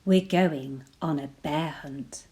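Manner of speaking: plain reading voice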